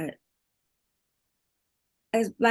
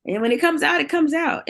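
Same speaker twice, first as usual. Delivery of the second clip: higher and louder, pressing the point; emphatic; 9 semitones higher, 6 dB louder than usual. Real, not acted. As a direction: let it burst out like the tears do. Emphatic point after laughing.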